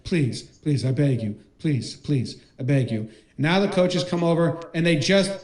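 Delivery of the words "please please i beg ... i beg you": low, sorrowful pleading; pitiful plea in a lowered voice; mock begging. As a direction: act out a defeated wrestler begging the judges. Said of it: "he starts begging them".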